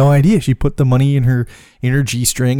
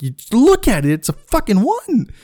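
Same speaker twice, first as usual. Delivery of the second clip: exasperated insistence; 9 semitones higher than usual. Retold or imitated. Arguing with friend over bill.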